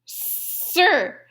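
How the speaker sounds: high and loud, scolding; indignant shout. A narrator's reading. Mouse dentist shouting at fox from the window. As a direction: call down firmly, no entry.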